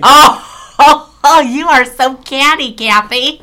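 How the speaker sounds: high, loud mock-shocked gush over the friend; mock scandalized. Real, not acted. Teasing her friend Kathy, high and loud mock-scandalized gush. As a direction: play it up, faux outrage at the cattiness.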